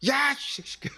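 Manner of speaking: high, gruff mock swearing at someone; joking impression of a pastor cursing at a church member; mock rough curse. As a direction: bark it like a rude pastor, half joking. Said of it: "목사 뭐라고 얘기했으면 좋겠어요?".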